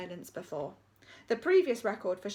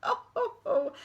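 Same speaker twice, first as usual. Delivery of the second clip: put-on high old-lady chuckle; amused old headmistress; chortle; 9 semitones higher, 4 dB louder than usual. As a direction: chortle it like a giggling granny. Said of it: "chortled Miss Stint".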